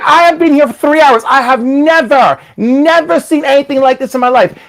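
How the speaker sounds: exasperated tester. Retold or imitated. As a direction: play the fed-up drug tester. Tester's exasperated warning, acted.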